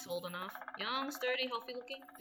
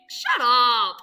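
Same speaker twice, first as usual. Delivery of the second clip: angry shout; 18 dB louder than usual. Acted. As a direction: bark it out, very loud. Angry outburst in scene.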